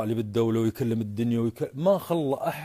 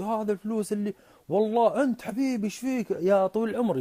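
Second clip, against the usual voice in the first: high-pitched, effusive flattery; fawning commander; 10 semitones higher than usual. Acted. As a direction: placate him with gushing affection. Commander flatters while paying up. (بتملق وتودد وصوت عالي النبرة)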